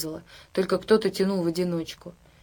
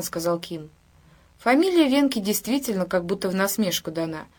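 ordinary delivery, nothing marked

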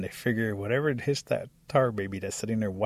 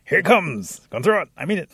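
louder, higher last warning; acted threat; 7 semitones higher, 8 dB louder than usual. A narrator's reading. Rabbit about to throw the stick, threatening loudly. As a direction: warn them loudly that you mean it.

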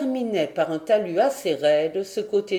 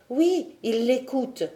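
put-on a boyish voice, a bit higher; boy's eager confirmation; 5 semitones higher than usual. The boy assures the major from his perch, eagerly. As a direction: confirm it eagerly, like a lad on lookout. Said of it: "reprit Robert".

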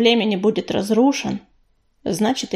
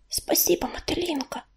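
hushed, whispered thanks; 3 semitones higher, 11 dB quieter than usual. A narrator's reading. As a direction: whisper it very softly to a friend. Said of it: "шепнула Листвичка". (шёпотом, очень тихо)

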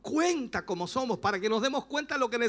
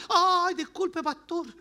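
high, suddenly meek apology; mock embarrassed backing down, pitched up; sheepish apology; 6 semitones higher, much slower than usual. Driver realizing he yelled at his pastor, sheepish apology.

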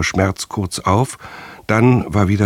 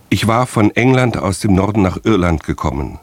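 ordinary delivery

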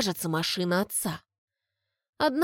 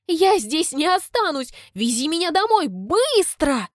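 demanding daughter; 8 semitones higher, 4 dB louder than usual. A narrator's reading.

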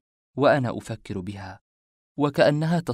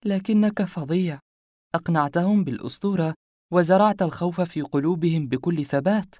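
higher, louder woman's voice; lively and impressed, pitched up; teasing woman character; 7 semitones higher, 4 dB louder than usual. A narrator's reading. Woman to the man who fooled the thugs, lively higher voice.

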